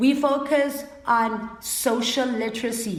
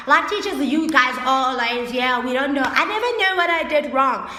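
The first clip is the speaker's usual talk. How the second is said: imitating a complaining kid; 3 semitones higher, 5 dB louder than usual. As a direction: whine like a confused student. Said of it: "They're like".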